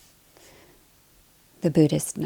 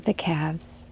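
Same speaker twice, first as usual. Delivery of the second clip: calm body-scan voice, short phrases between long silences; guided meditation; 4 dB quieter than usual. Acted. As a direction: lead them gently through the body, leaving space to breathe.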